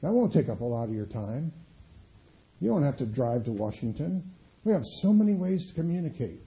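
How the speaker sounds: ordinary talk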